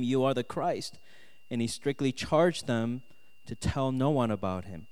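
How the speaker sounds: ordinary talk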